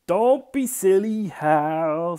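loud, high, drawn-out telling-off; scornful older sibling. Acted. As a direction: scold a little brother, stretching it out. Older sibling scolds Hal.

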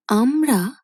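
startled. A narrator's reading. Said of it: "আর একবার চমকে ওঠেন".

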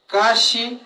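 kashi set high and firm; Mixtec tone demo. Shown as a demonstration. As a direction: lift kashi high and say it cleanly. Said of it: "pero en su entonación ya, ya cambia".

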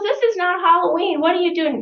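shocked disbelief. Retold or imitated. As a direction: gasp it out like you can't believe your eyes. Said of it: "And he goes".

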